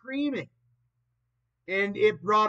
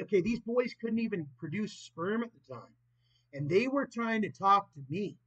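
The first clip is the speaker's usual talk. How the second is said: ordinary talk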